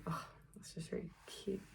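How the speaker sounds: soft, whispery gushing; retold reaction, nearly whispered; touched reaction. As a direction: melt into a hushed aww. Own touched reaction to excited fans.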